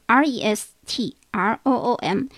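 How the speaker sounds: teacher spells the word slowly; slow spelling. Shown as a demonstration. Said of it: "温馨这个提醒一下".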